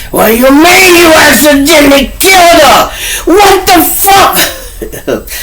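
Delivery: high, loud disbelieving yell breaking into a laugh; shocked shout. Pretend. Turk yelling at his friend about his mama, shocked shout. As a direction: scream it in outraged disbelief, ending slow.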